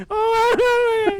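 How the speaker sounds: a very high, loud mock wail; retold crying wail. Boy caught stealing, wailing in front of his mother, high mock cry. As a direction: wail like a child caught, played up for the story. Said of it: "crying and all this".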